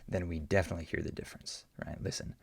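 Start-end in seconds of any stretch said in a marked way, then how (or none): none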